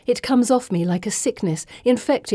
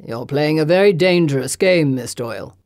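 menacing Lord Denby; 3 semitones lower, 6 dB louder than usual. A narrator's reading. Lord Denby warning Miss Doyle, menacing. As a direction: threaten her coolly.

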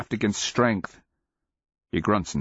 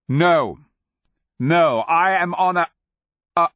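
desperate; 8 semitones higher, 6 dB louder than usual. Acted. Pleading as captive princess.

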